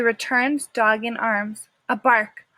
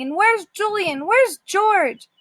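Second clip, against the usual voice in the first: panicked; 8 semitones higher, slower than usual. Acted. Panicked dog searching for family.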